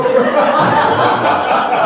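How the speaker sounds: a big amused laugh from the teller; hearty laugh. Acted. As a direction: laugh out at the tall tale. Storyteller laughs aloud.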